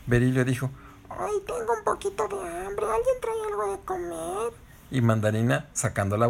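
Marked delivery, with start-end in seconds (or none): 1.1-4.5 very high squeaky insect voice; hungry little insect. Acted. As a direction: ask for food in a tiny odd voice. Voz de Berilio. (vocecita aguda de insecto con hambre)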